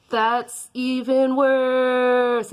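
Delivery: slow high scream skyward; screamed at the sky. Acted. She screams up at the sky at her nemesis, loud and slow. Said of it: "she screamed that up at the sky".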